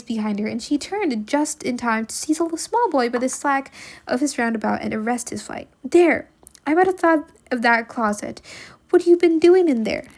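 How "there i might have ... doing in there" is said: put-on high, loud scolding of a caught boy; scolding aunt. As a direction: scold him loudly, pitched up.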